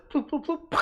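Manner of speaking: bouncy bloops ending in a mock splat; imitated hopping noises. Storyteller showing the rogue falling off the roof, cartoon sound effects.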